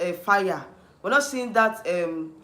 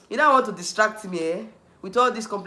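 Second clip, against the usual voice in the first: normal talking voice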